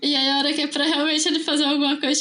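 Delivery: normal talking voice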